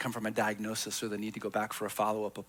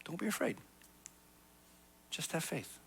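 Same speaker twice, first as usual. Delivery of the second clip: much softer and higher, a tender hushed comfort; gentle reassurance; 5 semitones higher, 9 dB quieter than usual. Acted. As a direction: soothe someone who just got the worst news. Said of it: "someone looks at you and says".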